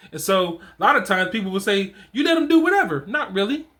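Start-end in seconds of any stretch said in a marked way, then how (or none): 2.1-3.0 mock accusation. Critics quoting lenient father.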